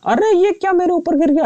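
jolted by a sudden hit; startled surprise. Real, not acted. Gamer startled as something falls on his army, high surprised yelp.

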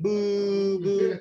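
playful high boo, teasing a friend; mock booing. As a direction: jeer him lightly like a heckler. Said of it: "Steven is like".

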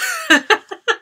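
squealing giggle; high excited cackle; high delighted laugh. Real, not acted. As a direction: burst into a giddy laugh. Gleeful laugh.

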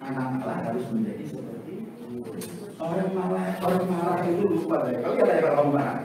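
ordinary delivery

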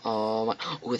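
normal talking voice

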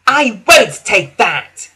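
high, slow horrified cry; horror. Acted. Daisy refusing the milk wagon in horror. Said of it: "she said in horror".

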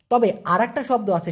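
normal talking voice

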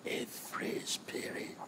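breathy hushed whisper to the congregation. Acted. Preacher whispering to his congregation, hushed. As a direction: hush it like a secret.

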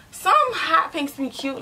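normal talking voice

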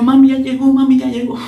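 high, loud excited call to mom; girl calling her mom when her date arrives, thrilled; excited announcement. Retold or imitated.

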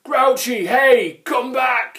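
high, loud, urgent calls; calling out. A narrator's reading. Grunt calls after his departing friend, loud and high. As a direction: call desperately after someone leaving. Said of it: "he called".

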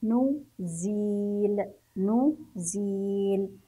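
نزيل with a long, held ee; very slow نزيل, sustaining the ee vowel; stretched kasra. Shown as a demonstration. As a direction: draw out "zeee" in نزيل. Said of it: "ونكسره ونمده بالكسر".

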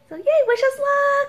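high, loud, bubbly cheer; squealing wish us luck; excited cheer. Real, not acted. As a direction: cheer them on. Cheerful send-off to camera.

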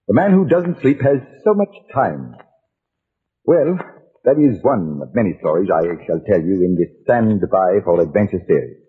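usual speaking voice

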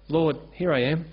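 ordinary talk

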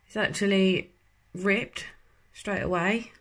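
while peeling off a sheet mask; wearing a face mask. Real, not acted. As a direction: hands pulling at mask near mouth. Seen 3: Gold sheet mask on face throughout; mouth mostly free, sometimes stretched.

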